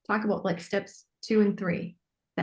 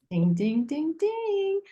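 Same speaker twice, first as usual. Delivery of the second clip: bell ding; 8 semitones higher, much slower than usual. Real, not acted. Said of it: "signals a correct answer".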